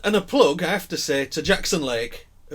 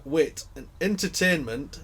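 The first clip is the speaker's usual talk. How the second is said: normal talking voice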